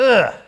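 loud, high "ugh" of disgust; disgusted groan. Acted. Everybody reacting to dogs licking sores, loud disgusted groan. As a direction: groan as if grossed out. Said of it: "everybody goes".

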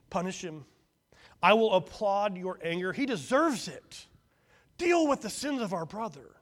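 eager urging. A narrator's reading. Fault-finding son urging firstborn to punish brother, loud. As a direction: egg him on to punish.

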